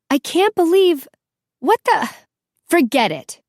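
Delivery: high, loud sputtering outburst; too angry to finish a sentence; exasperated. A narrator's reading. Furious outburst at both boys.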